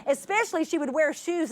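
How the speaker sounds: ordinary talk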